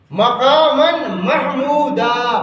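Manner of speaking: محمودا slowly as "mah-MOO-daa"; correct pronunciation. Shown as a demonstration. Teacher quoting the Quran as proof, correct pronunciation. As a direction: stretch vowels, sound h in محمودا. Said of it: "قرآن مجید میں کیا ہے".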